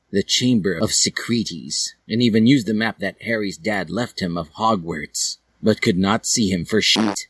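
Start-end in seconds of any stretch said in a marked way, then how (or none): none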